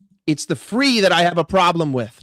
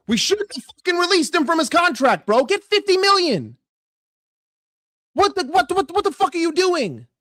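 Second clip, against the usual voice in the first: exasperated outburst; 8 semitones higher than usual. Real, not acted. Fan ranting at the club's decisions, raised exasperated voice. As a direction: pitch jumps high in disbelief, voice strained. Furious rant about releasing Salah.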